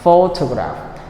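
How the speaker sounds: PHOtograph with a strong opening syllable; stress on first syllable. Shown as a demonstration. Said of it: "is on the first syllable".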